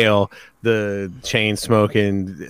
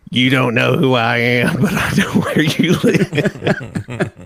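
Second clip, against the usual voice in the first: laughing through the quote; 4 semitones higher, 3 dB louder, slower than usual. Retold or imitated. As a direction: quote breaking into laughter, slow. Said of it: "and he says".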